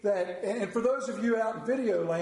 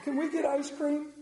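put-on child's quick recovery; 7 semitones higher, 3 dB quieter than usual. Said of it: "looks at you and says".

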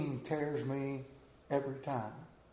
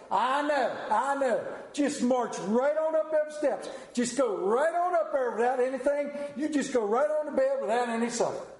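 higher and raised, a stern father's scold; 6 semitones higher than usual. Retold or imitated. Father scolding son, sending him to bed angry. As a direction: send him up the steps, no supper.

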